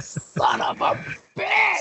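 slow build to a high shout; exasperated cursing. Acted. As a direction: drag it out, then explode on the last word. Exasperated at meeting Candy again.

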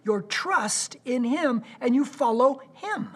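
impassioned high, loud altar call; preaching. Acted. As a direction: preach it out, pleading. Altar call at full force.

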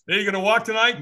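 lightly voiced friendly stranger; stranger's voice. Retold exchange with stranger.

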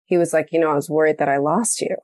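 ordinary talk, nothing marked

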